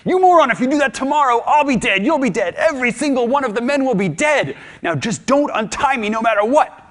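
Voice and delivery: hammed-up furious yelling, high and loud; furious outburst. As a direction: explode at the fool who untied you. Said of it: "Odysseus flips out".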